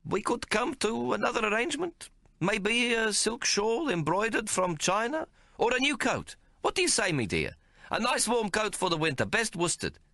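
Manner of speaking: high, quick wheedling pitch; haggling tailor. A narrator's reading. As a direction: talk the price up fast.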